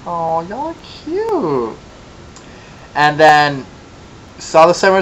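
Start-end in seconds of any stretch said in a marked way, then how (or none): 0.1-1.8 slow, cooing and tender; doting coo. Retold or imitated. Gushing at a cute couple, slow and soft. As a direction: coo over an adorable older couple on the monorail. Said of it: "I was like".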